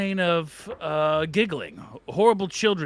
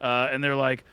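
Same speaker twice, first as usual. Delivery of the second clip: ordinary delivery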